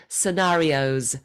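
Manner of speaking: Scenarios said slowly, ending on a buzzing Z; ending in a Z sound. Shown as a demonstration. Coach modelling the final Z for Luis. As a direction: land Scenarios on a clear Z. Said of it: "it ends with the Z".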